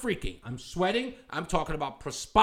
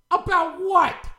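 scornful disbelief; 12 semitones higher, 8 dB louder, slower than usual. Real, not acted. Scornful question to pundit.

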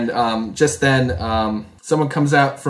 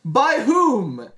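smug retort; 10 semitones higher than usual. Acted. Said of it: "he'd be like".

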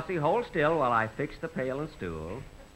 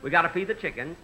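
normal talking voice

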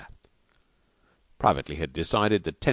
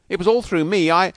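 high, louder outburst; agitated self-blame; 10 semitones higher, 7 dB louder than usual. A narrator's reading. Husband blaming himself to the doctor, agitated outburst. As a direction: blurt it out high and loud.

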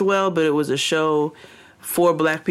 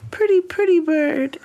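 high sing-song parrot voice; 11 semitones higher than usual. Retold or imitated. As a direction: chirp it like a talking bird. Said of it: "he's like".

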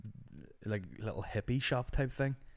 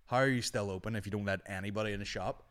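ordinary delivery

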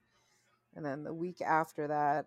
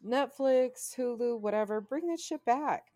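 fervent fan demand; 8 semitones higher, 5 dB louder than usual. Real, not acted. Podcaster pleads with streaming services to revive Firefly, fervent demand. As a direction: demand it loudly, pitch climbing.

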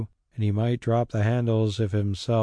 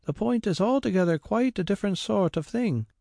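much higher woman's voice, eager; a maid assuring her master; servant Mary; 11 semitones higher than usual. A narrator's reading. Mary assures the squire, high eager voice.

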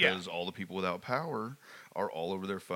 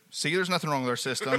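louder, smug laughing gloat; smug cashier; 7 semitones higher, 7 dB louder than usual. Pretend. Cashier gloating at the customer, smug and laughing. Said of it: "I would be like".

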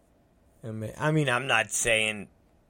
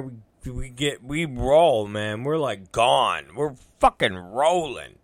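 ordinary talk

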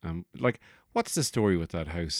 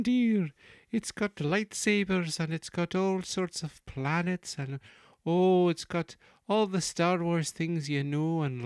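very high, hooting nanny falsetto; Missus Doubtfire; 11 semitones higher, 3 dB louder than usual. Acted. Actor plugs his series, high Doubtfire impression. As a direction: coo it in a shrieky-high housekeeper voice. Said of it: "Missus Doubtfire voice".

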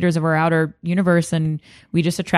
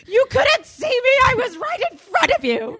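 put-on indignant kid. Child protesting to her mom, high indignant shout. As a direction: insist you were right in front, shouting.

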